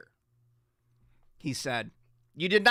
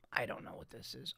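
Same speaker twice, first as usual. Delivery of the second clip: under breath; 7 semitones lower, 16 dB quieter than usual. Real, not acted. Embarrassed at old photo.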